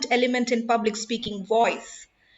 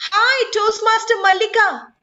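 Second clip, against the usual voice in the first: much higher and louder bright greeting; high pitch; 9 semitones higher, 6 dB louder than usual. Shown as a demonstration. Trainer greets Toastmaster Mallika to show high pitch, high and loud. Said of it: "how high and low you can go".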